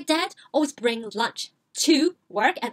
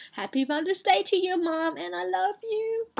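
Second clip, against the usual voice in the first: tender; 5 semitones higher than usual. Real, not acted. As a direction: speak lovingly to your mom. Speaking directly to mom.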